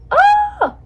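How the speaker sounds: sudden high, loud "ah"; short loud squeal; startled cry. Real, not acted. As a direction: yelp in alarm, very high-pitched. Startled mid-recording by a situation.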